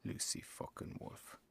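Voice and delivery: hushed unvoiced curse under the breath; whispered curse. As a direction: spit the name out in a whisper. Muttering a curse about author.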